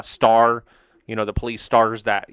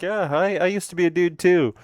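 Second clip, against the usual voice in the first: cheeky pony voice; 7 semitones higher than usual. Retold or imitated.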